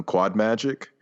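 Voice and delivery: ordinary talk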